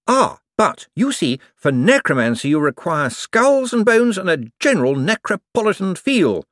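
high, eager, bright-voiced wizard; a jumpy official covering for himself. A narrator's reading. Hicks lecturing on necromancy.

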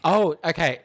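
sudden high, loud oh of surprise; startled oh, okay; surprised oh. Real, not acted. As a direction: caught off guard, then catch up. Reacting to surprising advice.

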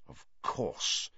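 soft, under the breath; muttered. A narrator's reading. Sirius realising what Crouch did, muttering. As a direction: mutter it softly to yourself. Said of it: "Sirius muttered".

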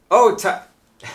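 cheerful Australian sailor voice; Australian accent. Acted. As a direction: greet him in a hammed-up Australian accent. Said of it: "he's gonna be doing an Australian accent".

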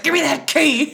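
high, loud snarl, half laughing; menacing demand. Retold or imitated. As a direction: bark it out like a sudden villain. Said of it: "her voice, she's like".

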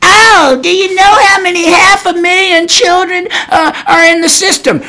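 put-on high voice of someone pleading a case; mock protest. Mock quote of a common argument.

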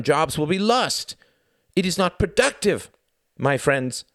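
desperate cry. A narrator's reading. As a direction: cry out the point, half-genuinely desperate. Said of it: "cried the man in slightly more genuine desperation".

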